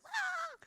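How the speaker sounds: squeaky startled cry; high startled yelp. Retold or imitated. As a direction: shriek in surprise. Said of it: "he was just like".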